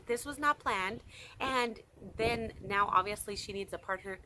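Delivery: ordinary talk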